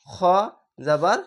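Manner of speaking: ordinary delivery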